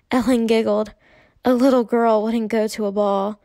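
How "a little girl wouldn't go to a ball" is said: ordinary delivery, nothing marked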